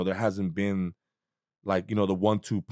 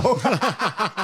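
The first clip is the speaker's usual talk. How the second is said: mock startled yelp; 11 semitones higher than usual. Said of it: "He's like".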